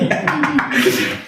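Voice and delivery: real amused laugh; giggling at the joke; high burst of laughter. Real, not acted.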